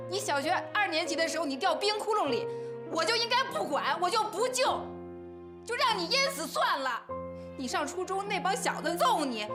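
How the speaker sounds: high-pitched, hurt indignation; wronged sister's rising, bitter protest; aggrieved protest. Acted. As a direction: climb high as the grievances pile up. Sister defends herself in acted quarrel.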